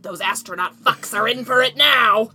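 hammed-up gross voice, stretched slowly; gross inner voice. Retelling the voice in a friend's head, gross voice. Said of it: "a gross voice in her head that said".

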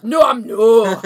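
shouted Scottish No, I'm no; Scottish accent. Shown as a demonstration. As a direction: really lean into the Scottish accent. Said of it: "You really gotta just lean into it".

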